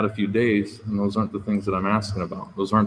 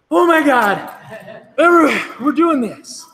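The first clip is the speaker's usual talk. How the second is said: high, loud mock panic; mock hysterical oh my God, much higher and louder; panicked freakout; 9 dB louder, slower than usual. Old self panicking at a coworker, mock hysterical shrieking. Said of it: "And then I go".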